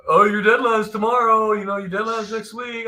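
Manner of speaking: high sing-along pestering reminder; mock nagging. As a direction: imitate someone bugging an artist about deadlines. Editor mimics nagging about deadlines.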